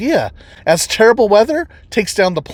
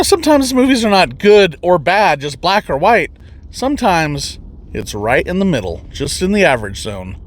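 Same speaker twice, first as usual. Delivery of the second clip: ordinary delivery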